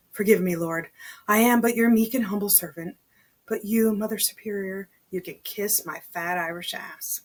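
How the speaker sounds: plain reading voice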